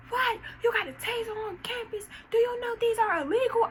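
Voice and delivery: high, loud imitated scolding officer; mock a shrill outraged guard; hammed-up shocked security officer. Officer scolding about taser.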